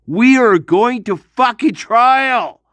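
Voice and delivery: shouting. Real, not acted. Ranting about taking case to trial.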